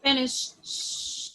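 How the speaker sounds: "Finish" then a long slow "sh-" hiss; teacher stretches out the sh sound slowly. Shown as a demonstration. Teacher isolating the sh sound for students. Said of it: "sh-, this sound".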